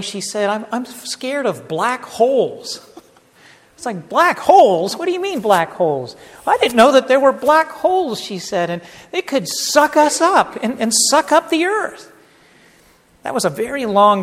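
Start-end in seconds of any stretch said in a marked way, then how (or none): 0.5-2.9 slow mock-tearful child's confession; imitated tearful blubbering. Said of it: "through tears and blubbering". 4.1-6.2 baffled disbelief. Retold or imitated. Father retells his baffled reaction. 6.5-8.2 high, loud mock wail; imitated upset child. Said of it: "through tears and blubbering". 8.7-12.1 high-pitched mock-panicked child; imitated upset child. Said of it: "through tears and blubbering".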